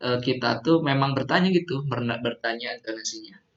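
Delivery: normal talking voice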